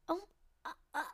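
high, breathy, flustered stammer; flustered Dave. Retold or imitated. Reader voicing Dave flustered as Charlie praises him, high flustered stammer. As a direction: squeak it out like a man caught off guard.

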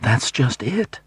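a touch of rising alarm; high and panicky. A narrator's reading. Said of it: "her voice shot up, high and panicky".

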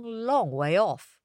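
ordinary delivery, nothing marked

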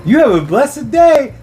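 high, friendly critter voice; cheerful creature voice. Pretend. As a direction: bless them sweetly as a little creature. Water bear blessing its eater.

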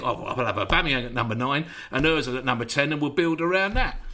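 low, fast, laughing mockery of a clueless manager; a lightly sent-up smug tactician; mock manager's voice. Mocking the manager's thinking.

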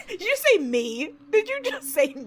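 high, laughing incredulous question; amused disbelief. Real, not acted. Questioning a co-host's self-mocking remark, laughing disbelief. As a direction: pitch it way up, astonished and amused.

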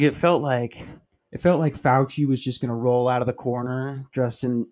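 ordinary talk

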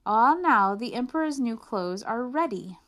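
proud swindlers unveil; grand declaration. Acted. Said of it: "they declared".

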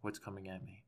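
soft, tearful fake apology; mock-sorry creator statement; YouTube apology voice. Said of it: "this is mine".

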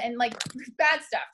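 ordinary delivery

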